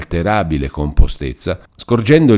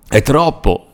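sobbing; 8 semitones higher, 4 dB louder, slower than usual. A narrator's reading. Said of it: "disse singhiozzando l'innominato".

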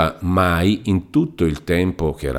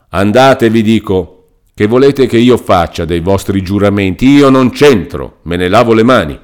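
loud, indignant scolding; furious lawyer dismissing Renzo; shouting lawyer; 5 dB louder than usual. Acted. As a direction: drive the fool out of the room. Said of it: "sempre gridando".

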